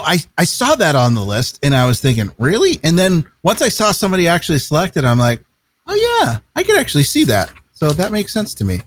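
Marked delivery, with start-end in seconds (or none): 2.4-2.8 higher-pitched questioning "really"; surprised disbelief. Retold or imitated. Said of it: "I was surprised by this one". 5.9-7.5 high-pitched dawning agreement; sudden realization. Retold or imitated. Acts out coming round to it.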